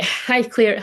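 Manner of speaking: ordinary delivery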